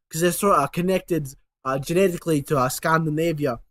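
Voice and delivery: normal talking voice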